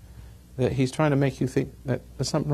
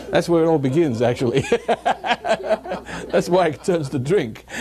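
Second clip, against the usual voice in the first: laughing; 4 semitones higher than usual. Real, not acted. Preacher to congregation, laughing through the punchline. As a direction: let the laugh spill into the words.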